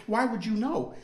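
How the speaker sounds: normal talking voice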